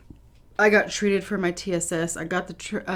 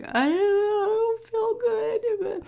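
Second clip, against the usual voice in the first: mock whimper. Patient answering the doctor's question, mock whimper. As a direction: whimper it high, acting out feeling awful.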